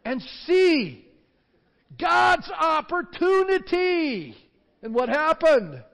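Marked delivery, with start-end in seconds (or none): 0.0-4.4 high, loud and slow proclamation; commanding Moses. Retold or imitated. Moses commanding the people to stand firm. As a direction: proclaim it over the crowd, drawn out. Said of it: "He said".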